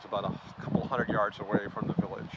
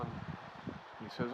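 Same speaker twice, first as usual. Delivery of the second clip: usual speaking voice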